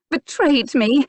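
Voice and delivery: high, loud, hitting the word; bitter emphasis. A narrator's reading. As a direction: land the word like a blow. Finds the strong word.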